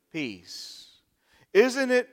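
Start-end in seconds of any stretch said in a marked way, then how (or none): 0.1-0.9 hushed peace. Acted. Soft repeated peace.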